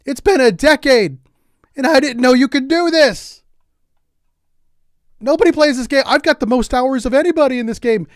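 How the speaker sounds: loud, high-pitched disbelief; amazed outburst. Real, not acted. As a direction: blurt it out, astonished at a late discovery. Amazed at discovering the menu scrolls.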